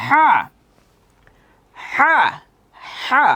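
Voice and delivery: "হা" with a strong breathy h from the throat; deep-throated letter. Shown as a demonstration. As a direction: squeeze air through the throat on "হা". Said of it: "একটু গাঢ় করে উচ্চারণ".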